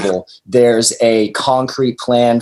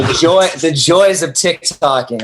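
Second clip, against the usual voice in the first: sardonic, pitched up high with a wry edge; ironic; 6 semitones higher than usual. Acted. Band member joking to the host about TikTok, mock-cheerful. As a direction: sell the joys with mock enthusiasm.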